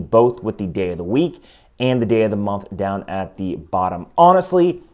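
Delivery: ordinary talk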